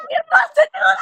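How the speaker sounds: high squealing exclamation; shrieking. Real, not acted. Shrieking at the fic.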